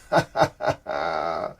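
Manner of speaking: low, soft chuckle. Real, not acted. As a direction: laugh quietly to yourself. Chuckles while greeting viewers.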